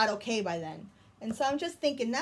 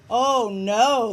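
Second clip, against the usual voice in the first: louder, drawn-out dismay; 10 dB louder than usual. Acted. Norman sees Jim trip over Snake, dismayed. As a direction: groan it out in alarm.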